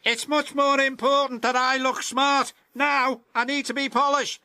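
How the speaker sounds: high, fast, self-important engine voice; a show-off brushing off his friends; vain James. Acted. James refusing to help his friends, high vain voice.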